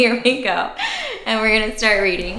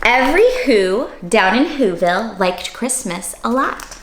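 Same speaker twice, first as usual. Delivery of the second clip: lively read-aloud storytelling voice; reading to children; 3 semitones higher than usual. A narrator's reading. Teacher reading the Grinch to children, lively and animated. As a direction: read it bright and animated for little listeners.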